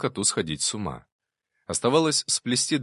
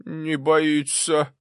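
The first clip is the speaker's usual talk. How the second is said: high, slow astonishment; astonished old servant; amazed gasp; 8 semitones higher, 4 dB louder, much slower than usual. A narrator's reading. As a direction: marvel at it in a high, stretched voice. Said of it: "удивлённо охнул Прохор".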